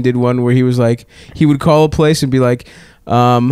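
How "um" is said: ordinary talk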